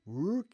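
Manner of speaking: mock French "rook" with a rising tune; "rook" said like "ook", punched up. Shown as a demonstration. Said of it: "That's how you say rook".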